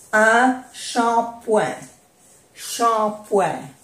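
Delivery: shampoing slowly, with a nasal oin ending; French pronunciation. Shown as a demonstration. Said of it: "Esto se pronuncia oin". (shampoing despacio, con oin nasal)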